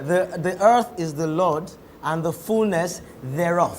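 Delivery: slow, careful English scripture recitation; picking out words. Shown as a demonstration. Host translating the Yoruba prayer into English, slow and careful. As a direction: pick it out slowly, word by word. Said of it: "see the way you are picking it".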